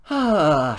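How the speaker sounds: loud, high, falling "ah"; exasperated groan. Acted. Grumbling at pets over a furball, exasperated groan. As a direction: let out a big exasperated groan.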